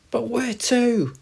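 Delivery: high, loud, drawn-out question; panicked hamster. Acted. Hamster fleeing the owl, high and panicked. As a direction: cry out in alarm, very high.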